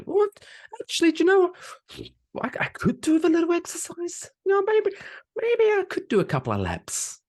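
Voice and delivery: high, put-on voice of a lazy guy talking himself into exercise; squeaky mock voice, suddenly keen on a workout; overweight guy. Mocking Berlin men who suddenly want to swim, in a high put-on voice. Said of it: "single guys in Berlin going".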